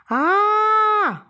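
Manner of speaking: high, loud shriek of terror; high piercing horror scream; terrified scream. Acted. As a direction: scream with the mouth wide open. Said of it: "வாய் கிளிய கத்தினாள்".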